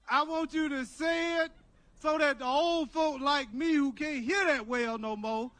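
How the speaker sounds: usual speaking voice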